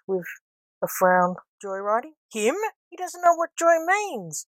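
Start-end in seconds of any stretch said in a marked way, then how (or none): 1.6-4.5 amused disbelief, climbing high; high-pitched, tickled mockery of the idea; tickled amusement. A narrator's reading. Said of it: "laughed the front passenger, tickled pink".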